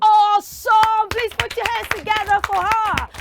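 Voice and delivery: high, loud, stretched-out praise; rally the audience with a loud cheer; excited cheer. Real, not acted. Host calls for applause for the girl, excited cheer.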